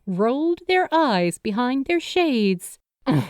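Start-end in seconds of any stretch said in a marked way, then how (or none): 3.1-3.2 put-on grunting young spirit. A narrator's reading. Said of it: "they grunted".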